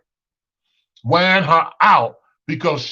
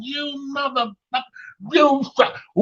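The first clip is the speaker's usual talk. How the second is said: high, shrill-free mock cussing of gaming kids, pitched way up; 10 semitones higher than usual. The kids cussing over the video game, mimicked high. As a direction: pitch up and play the cursing kids. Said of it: "everybody was cussing".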